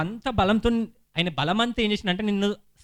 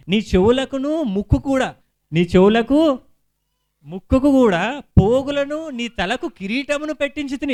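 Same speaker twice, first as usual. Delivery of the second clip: loud, raised preacher's voice; emphatic scripture reading; 5 semitones higher, 8 dB louder, slower than usual. Acted. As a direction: proclaim the verse high and loud. Reads scripture out forcefully.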